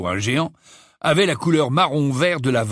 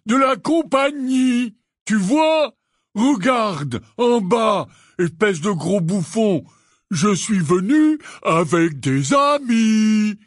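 higher, loud, slow calls; Hagrid shouting; 7 semitones higher, 3 dB louder, slower than usual. Acted. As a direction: bellow each word up to him.

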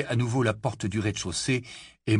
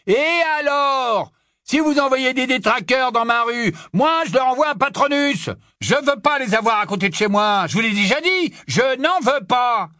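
high, loud tirade; furious yell; 13 semitones higher, 6 dB louder than usual. A narrator's reading. Barman warning masked men off his street, yelling. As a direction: yell a warning to stay away. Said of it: "hurlait-il au visage".

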